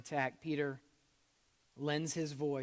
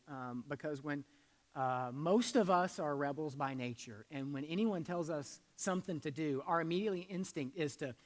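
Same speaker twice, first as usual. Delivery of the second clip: normal talking voice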